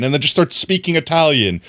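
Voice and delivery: ordinary delivery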